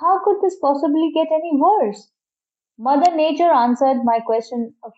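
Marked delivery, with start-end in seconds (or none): 0.0-2.1 high, exasperated outcry; shouted disbelief. A narrator's reading. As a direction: cry it out in disbelief. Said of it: "I shouted".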